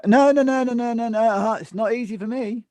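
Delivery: high, loud burst of hammed-up objection; mock indignant protest. Insisting to someone it's not easy, in mock indignation.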